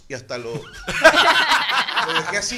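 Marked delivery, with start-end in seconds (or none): 0.6-2.0 burst of laughter. Real, not acted. Laughs at his reaction.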